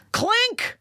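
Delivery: put-on a jokey German officer's 'Clink' in a German accent; mock German accent. Mocking a German accent on 'Clink' as a joke about an audition. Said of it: "That's your German accent is saying clink".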